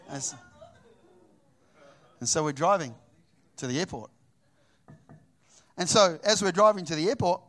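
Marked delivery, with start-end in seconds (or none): none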